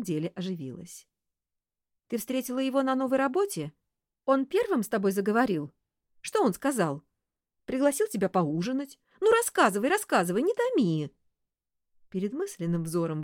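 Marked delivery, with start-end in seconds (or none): 2.1-9.0 eager. Acted. Eager sister pressing for details. 9.2-9.9 high, pushing first ask; eager urging. Acted. Repeated urging. 9.9-11.1 eager. Acted. Eager sister pressing for details.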